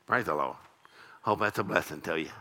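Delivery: a shrugging churchgoer who gave nothing; stingy giver. Retold or imitated. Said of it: "if you can give and go".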